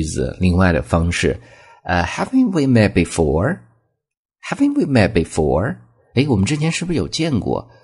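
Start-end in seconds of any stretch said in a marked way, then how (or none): 2.0-5.8 Haven't we met before lifted up on before; rising question tone. Shown as a demonstration. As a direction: ask it lightly, rising at the end. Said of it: "一般疑问句，用声调". (一般疑问句，句尾上扬)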